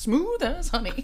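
slow smooth sultry purr; sultry ringtone voice. Pretend. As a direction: croon it like a ringtone. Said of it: "I got a new text".

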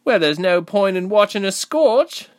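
high, loud insistence; insistent. A narrator's reading. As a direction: push the point firmly, pitched right up. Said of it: "she insisted".

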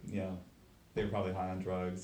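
ordinary talk

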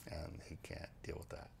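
breathy whispered aside, no voice. Real, not acted. Telling a friend about the rich man, in a whisper. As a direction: drop to a whisper for the punchline. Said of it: "whispered aside".

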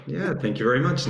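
ordinary talk, nothing marked

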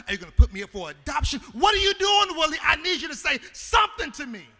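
fast, then a loud desperate outburst; frantic pleading. Retold or imitated. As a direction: spill it out, then break into a shout at the silent father. Son pleading with silent father.

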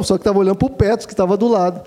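ordinary talk

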